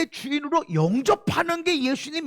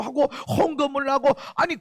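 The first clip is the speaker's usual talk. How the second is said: usual speaking voice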